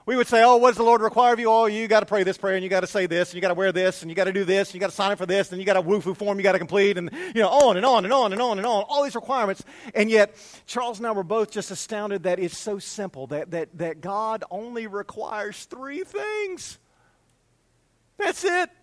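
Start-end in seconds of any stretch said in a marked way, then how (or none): 0.4-8.8 raised, rattled-off run of demands; mock officious list. Joking about God's endless requirements, mock officious rattle. 13.8-18.7 high, drawn-out disbelief; astonished. Real, not acted. Pastor astounded at only three requirements, high-pitched amazement.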